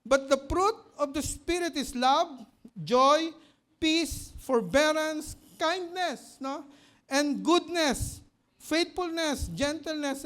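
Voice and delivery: slow, loud solemn Bible reading; scripture read from the pulpit; reading scripture aloud. Acted. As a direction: proclaim the verse word by word. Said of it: "sabi rito".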